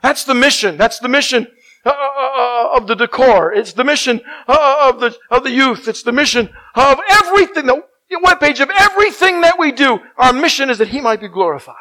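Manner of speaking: loud, high, impassioned plea; full-force preaching. Real, not acted. Preacher pleading with God before the congregation, loud and high. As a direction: cry out for revival.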